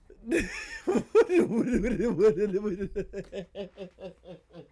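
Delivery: another helpless burst of laughter; cracking up at what he just heard; laughing. Real, not acted. Rereading the creator's claim, laughing again.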